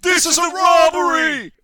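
high, loud robber yell; bank robber. Acted. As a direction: bark it like a robber holding up a shop. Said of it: "They yell".

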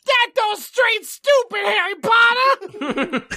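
very high, slow cartoon squeak; stretched squeaky schoolgirl scolding; brisk. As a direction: drag it out in a silly high voice. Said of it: "She said briskly".

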